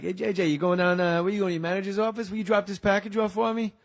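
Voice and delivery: put-on high, pushy Boston accent. West Side guy asking a favor, Boston accent. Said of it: "why they have Boston accents".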